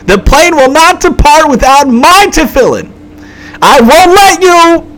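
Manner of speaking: frantic, very high loud screaming. Retold or imitated. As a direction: scream your demands like a passenger refusing to budge. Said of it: "So he started screaming".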